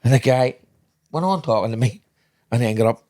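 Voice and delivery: usual speaking voice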